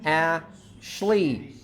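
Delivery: "Ashley" as a short "A", pause, then "shlee"; split before sh. Shown as a demonstration. Teacher asking students if it is possible, "A-shley". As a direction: leave a gap ahead of "shley" in "Ashley". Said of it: "Is that possible?".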